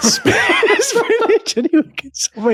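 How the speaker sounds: high laughing punchline, slowed down. Real, not acted. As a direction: laugh through the spinach joke. Laughing at name joke.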